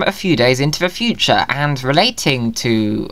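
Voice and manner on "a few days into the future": ordinary delivery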